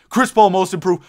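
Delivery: ordinary talk, nothing marked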